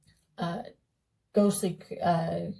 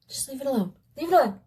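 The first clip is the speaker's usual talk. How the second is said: yelling at myself; 6 semitones higher, much faster than usual. Real, not acted. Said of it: "I'm just yelling at myself".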